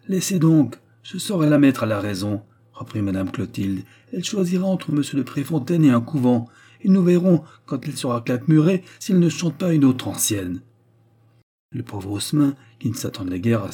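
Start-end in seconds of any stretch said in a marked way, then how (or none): 0.1-2.4 louder, raised-pitch brush-off; bossy, forceful retort; imperious dismissal. A narrator's reading. Mother brushes off husband's plea. 4.1-10.6 raised-pitch, forceful ultimatum; indignant, higher voice; angry threat. A narrator's reading. Mother threatens daughter with convent.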